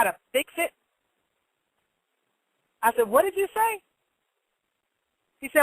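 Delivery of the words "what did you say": retold question, pitched much higher; high-pitched demand to hear it repeated; incredulous challenge. Parent challenging the cashier after an insult, incredulous.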